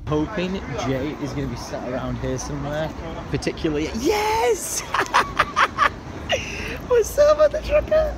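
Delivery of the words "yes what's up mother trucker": much higher, laughing yell of joy; delighted greeting. Real, not acted. Vlogger greeting returning friend Jeff, laughing excited yell. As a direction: greet your best mate after months apart.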